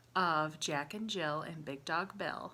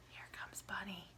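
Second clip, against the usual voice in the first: hushed secret storytelling; suspenseful whisper; 14 dB quieter than usual. Acted. Suspense before bunny arrives.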